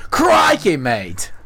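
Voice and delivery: high, loud Australian exclamation; big mock Australian outburst, dropping at the end; Australian accent. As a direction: burst out like a hammed-up Australian croc hunter. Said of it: "give it Australian a shot".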